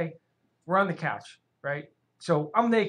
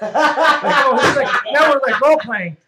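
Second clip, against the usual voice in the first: loud and laughing, pitched up; 5 semitones higher, 11 dB louder, slower than usual. Real, not acted. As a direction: laugh it out loudly.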